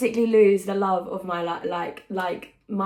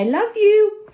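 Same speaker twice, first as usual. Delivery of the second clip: high squeaky sign-off, much louder; squealed goodbye; 13 semitones higher, 10 dB louder than usual. Real, not acted. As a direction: squeal a goodbye kiss at the camera. Signing off with a squeal.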